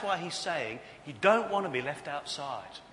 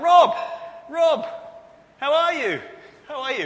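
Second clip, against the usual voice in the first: high, very loud re-enacted shout; yelled call; 12 semitones higher, 14 dB louder than usual. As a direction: holler eagerly to a pal across a pitch. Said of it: "you see, I yelled, called out".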